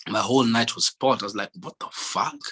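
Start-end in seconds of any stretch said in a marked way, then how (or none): none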